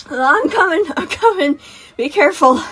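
high, loud, hurried reassurance; gasped call. Acted. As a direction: call out breathlessly to a child on the move. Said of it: "Sun gasped".

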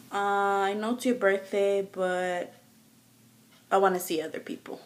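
soft, hesitant delivery; subdued break-up confession. Acted. Partner breaking up on a birthday, soft and hesitant. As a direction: let it out quietly, with awkward pauses.

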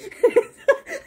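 delighted high-pitched laugh; real amused laugh. Real, not acted. Laughing at the scene.

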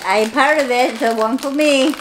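lightly voiced pleading pup; dog voice. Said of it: "She says".

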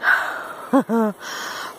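soft panting laugh; breathless laugh. Real, not acted. Climber laughing at the distance left, breathless.

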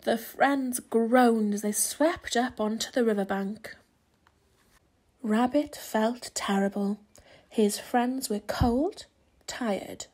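plain reading voice

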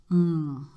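satisfied hum over tea; loud sipping hum. Real, not acted. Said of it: "I made that noise".

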